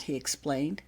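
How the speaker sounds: ordinary delivery, nothing marked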